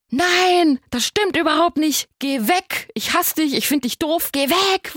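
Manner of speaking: high, loud child's yell imitating a four-year-old. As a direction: shriek it like an angry small boy. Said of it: "Wirklich so ganz laut". (wütend brüllendes Kind, hoch und laut)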